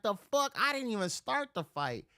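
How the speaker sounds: high, incredulous retort; indignant protest. Retold or imitated. Man protesting to police taking him to jail, indignant. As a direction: object with pitch jumping up.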